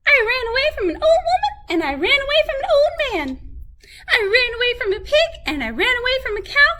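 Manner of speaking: very high, fast bragging; boasting gingerbread man. A narrator's reading. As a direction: rattle off the boast. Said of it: "said the gingerbread man".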